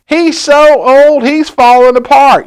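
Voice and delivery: put-on high, loud jeering child voice, slow on the middle; teasing kid. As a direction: sneer at the old snowman like a bratty kid. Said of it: "It's like".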